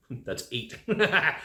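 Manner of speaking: chuckling at himself; laughing. Real, not acted. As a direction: laugh through the words.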